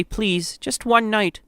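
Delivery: high, small pleading voice; begging. A narrator's reading. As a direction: beg the guard for mercy. Mother begs the guard.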